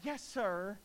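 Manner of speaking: long huffed exhale, then a grudging yes sir; exasperated teen sigh. Retold or imitated. Daughter huffing at her dad before a sullen yes sir. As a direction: sigh out loud like a put-upon teenager. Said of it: "I'm going to illustrate it for you".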